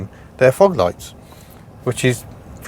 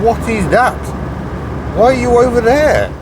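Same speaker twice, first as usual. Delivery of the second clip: incredulous; 9 semitones higher than usual. Real, not acted. Baffled at bad lane driving.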